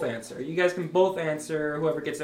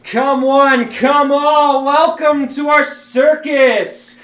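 booming showman announcer, slow finish; circus ringmaster; 8 semitones higher, 8 dB louder, much slower than usual. Acted. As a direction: call in the crowd like a ringmaster. Ringmaster opening the circus show.

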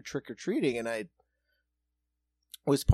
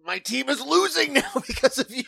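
high, loud mock complaint; mock whining dad; 11 semitones higher, 6 dB louder than usual. Friend voices the dad blaming her for his team, high mock whine. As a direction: blame her like a sulking fan.

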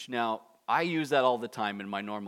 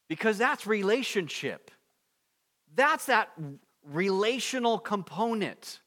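high, loud, fervent preaching; impassioned preaching; 8 semitones higher, 6 dB louder than usual. Real, not acted. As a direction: preach it with rising fervor. Preaching at full force, higher.

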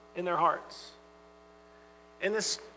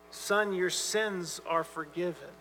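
softer, slow, gentle pardon; tender and gracious; 2 semitones lower, 7 dB quieter, slower than usual. Retold or imitated. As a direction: let each word land gently and slowly. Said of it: "it's got a tenderness to it".

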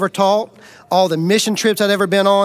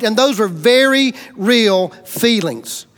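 ordinary talk, nothing marked

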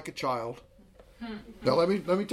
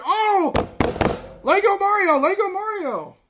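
put-on very high, loud squeal of a thrilled child; high-pitched begging with delight; excited kid; 8 dB louder than usual. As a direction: gush over the toy like a four-year-old. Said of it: "we go to the store".

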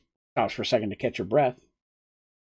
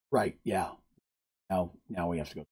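subdued; 9 semitones lower, 11 dB quieter than usual. Acted. Friends sobered, reluctant.

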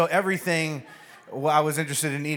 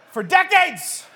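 high, loud exasperated yell; explosive, much higher outburst; shouted punchline; 14 semitones higher, 8 dB louder, slower than usual. Real, not acted. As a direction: shout it for comic exaggeration. Comic shouted punchline after pause.

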